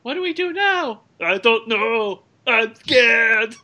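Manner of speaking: high, loud mock panic; mock scared Terminator. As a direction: squeak out a scared robot. Quoting the Terminator sketch.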